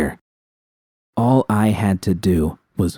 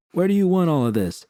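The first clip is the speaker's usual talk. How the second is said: a higher, distinct henchman voice; other thug calling; 10 semitones higher than usual. A narrator's reading. Henchman asking the boss where the boxes go, calling. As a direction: ask from across the warehouse. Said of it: "a voice called out".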